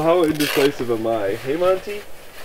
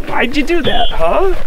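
high-pitched teasing question; playful dog talk; 12 semitones higher, 4 dB louder, slower than usual. Real, not acted. Owner asking dog why he charged, high playful voice.

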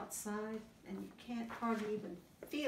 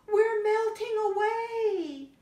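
high, slow and louder; high slow melting cub; 14 semitones higher, 9 dB louder than usual. Acted. Smallest snow bear melting by the fire, high slow wail.

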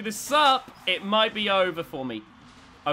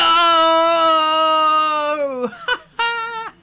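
loud, high whooping laugh of triumph; ecstatic laughing shout; 9 semitones higher, 10 dB louder than usual. Real, not acted. Player laughing and whooping after scoring a point, ecstatic laugh. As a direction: shriek with laughter at the win. Celebrating a robot battle win.

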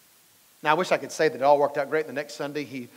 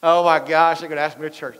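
mock alarm; 5 dB louder than usual. Mocking people avoiding invitations.

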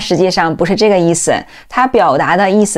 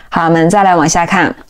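ordinary delivery

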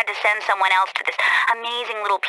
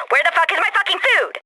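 Carrie freaking out; 6 semitones higher than usual. Retold or imitated. Assistant relays hungry boss's outburst to the courier, mock freak-out. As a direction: imitate the boss's outburst at a higher pitch. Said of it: "having a serious freak out here".

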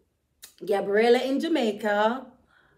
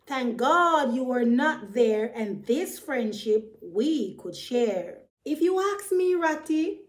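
usual speaking voice

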